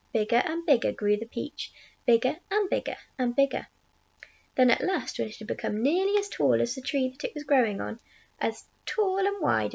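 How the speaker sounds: usual speaking voice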